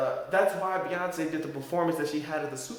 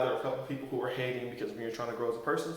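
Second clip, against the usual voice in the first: ordinary talk, nothing marked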